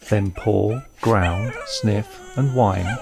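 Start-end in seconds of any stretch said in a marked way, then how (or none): none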